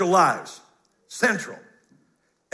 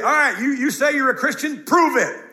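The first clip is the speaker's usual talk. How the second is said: confrontational challenge; 6 semitones higher, 4 dB louder than usual. Retold or imitated. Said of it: "John would get in your grill".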